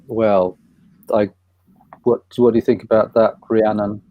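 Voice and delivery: ordinary talk, nothing marked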